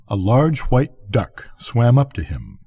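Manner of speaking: usual speaking voice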